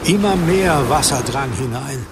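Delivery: plain reading voice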